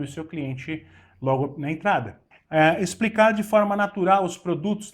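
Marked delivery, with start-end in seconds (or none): none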